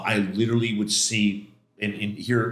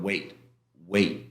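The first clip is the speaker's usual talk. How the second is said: crosswalk voice; 4 semitones lower, slower than usual. Retold or imitated. God heard like a crosswalk signal, measured. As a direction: imitate a crosswalk signal, evenly repeated. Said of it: "like one of those crosswalks".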